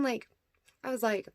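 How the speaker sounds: usual speaking voice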